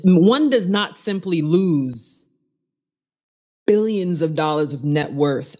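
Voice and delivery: drawn-out, mock-solemn; slow each word like a grave proverb; slow dramatic pronouncement. Host musing on lost billions, slow mock-solemn.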